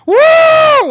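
loud, high woo; long, ringing cheer; crowd cheer. Acted. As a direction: whoop like a fan in the stands. Acts out cheering ballgame crowd.

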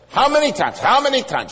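shrill loud high repeat; frantic wife. Retold or imitated. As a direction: plead with panic in your voice. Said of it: "She jumped in and she said".

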